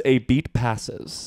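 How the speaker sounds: soft, very slow script reading; reading stage directions. Shown as a demonstration. Quoting the script to co-hosts, slow stage-direction reading. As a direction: draw out the stage direction quietly. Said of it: "In the script, it says".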